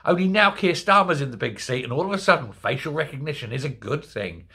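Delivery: normal talking voice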